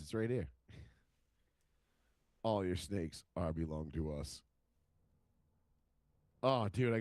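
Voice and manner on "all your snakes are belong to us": much lower-pitched parody announcement; video voice. Retold or imitated. Fan quotes the parody video, in a low put-on voice. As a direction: drop low and intone the meme line.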